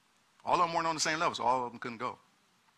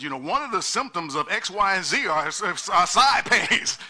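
louder, higher, rousing sermon push; preaching at full force; 5 semitones higher, 8 dB louder than usual. Acted. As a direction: preach it hard to the congregation.

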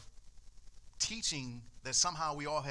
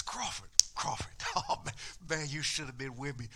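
excited friend; 2 semitones lower than usual. Retold or imitated. Friend retelling excitedly, whispered start.

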